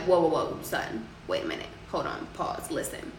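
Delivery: a mentor quietly reining someone in; hushed warning. Retold or imitated. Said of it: "his supervisor was like".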